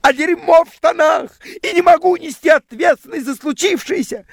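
very high, piercing mock shriek; hammed-up female shouting in falsetto; screaming woman. A narrator's reading. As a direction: screech the accusation like a hysterical woman. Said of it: "Она кричала мне".

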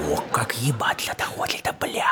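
laughing whispered aside; unvoiced giggling whisper. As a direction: breathe it out with a snicker. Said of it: "я думаю".

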